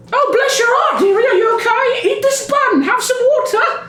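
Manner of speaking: mother him like a fretting grandma; high old lady fussing over the dazed customer; worried old baker lady. Acted. Tabitha fusses over dizzy Tezos.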